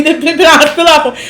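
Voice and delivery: high, fast nervous mutter through laughter; hammed-up panicky inner voice; mock tense. Host mocking Summer walking past Ivory, hammed-up tense. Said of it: "She was so tense".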